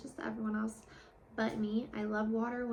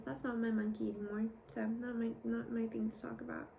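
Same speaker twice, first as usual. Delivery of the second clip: usual speaking voice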